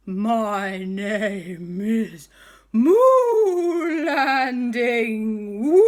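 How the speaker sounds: very slow, loud, drawn-out ghost voice; booming ghost. Acted. The ghost announcing his name to the boys, slow and booming. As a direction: stretch the name out grandly.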